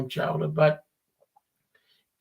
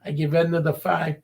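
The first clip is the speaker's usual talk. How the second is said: ordinary delivery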